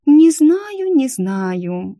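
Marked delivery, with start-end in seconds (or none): 0.1-0.9 very high, loud, whiny-pitched hesitation; drawn-out doubt. Acted. Picky client doubting a perfect candidate, high-pitched hedging.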